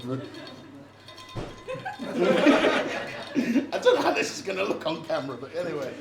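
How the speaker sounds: normal talking voice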